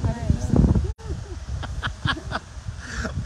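long, high burst of laughter; real amused cackling; teasing laugh. Real, not acted. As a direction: crack up at a high pitch. Teasing, mix-up on the live.

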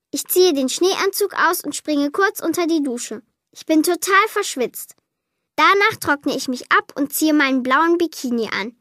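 ordinary delivery, nothing marked

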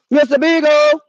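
calling out. Acted. Owner calling her lost dog through the woods, loud call. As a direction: call the dog's name loud and high.

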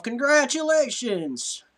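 high, drawn-out congratulations; stretched-out, elated letter reading; hearty congratulations. Acted. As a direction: read it out big and slow, high-pitched. Reads letter's opening with emphasis.

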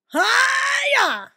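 high, loud hiya; long high attack cry; karate-style yell. Real, not acted. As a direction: shout it like a martial arts strike. Yell with a move.

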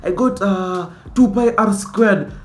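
a hint of a nerdy pest; annoying student voice. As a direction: needle the teacher with a pointless question. Mocking the annoying student's question.

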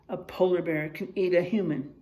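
ordinary talk, nothing marked

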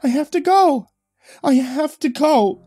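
high, loud insistent wail; desperate wail. A narrator's reading. Restrained wife insisting she must go, desperate wail.

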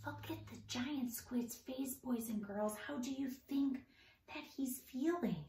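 softly hushed teacher voice. Acted. Teacher whispering to the children about the scared squid. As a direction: keep it low so the shark won't notice. Quiet near the shark.

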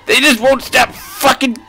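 high, exasperated complaint; getting mad. Real, not acted. Player fed up with enemies that won't stop, getting mad. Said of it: "getting mad".

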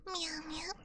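soft breathy kitten meows, rising; purr-like little cat calls for the chat; whispered meow. Pretend. Cat girl to her viewers, soft whispered meows. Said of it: "Would you like me to purse for you?".

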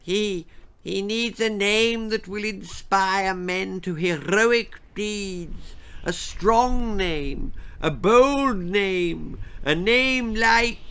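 put-on croaking old king. A narrator's reading. Frail king to his queen, croaking. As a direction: stammer like a doddering king. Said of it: "croaked the crumbling king".

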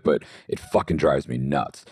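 usual speaking voice

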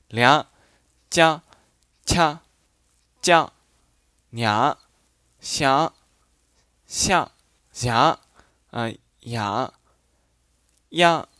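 each example syllable said slowly and separately; dialect readings. Shown as a demonstration. Teacher reads dialect words to the learners, carefully. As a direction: read the dialect sounds out one by one. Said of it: "我来读一下".

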